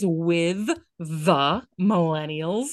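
WITH THE MILLENNIALS drawn out word by word; slow enunciation. Shown as a demonstration. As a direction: stretch "with the millennials" very slowly so each word is heard. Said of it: "so you better hear it in my voice".

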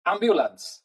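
high, falling Welsh "ambulance"; Welsh accent. Shown as a demonstration. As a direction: lift "ambulance" up Welsh-style and let it fall. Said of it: "with a more of a Welsh accent".

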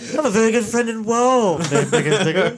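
high-pitched comic imitation through laughter; speech impediment. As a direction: mimic the film character's mangled speech, laughing. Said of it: "he's also got a speech impediment".

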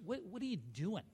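softly imitated judging question; judgmental. Brother looks at him and asks, judgmental. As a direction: drop the volume and let the judgment sit. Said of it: "saying things where you feel so judged".

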